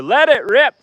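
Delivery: loud, high-pitched battle cry; catchphrase shout. Acted. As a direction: shout it like a launch call. Calling out a toy battle catchphrase.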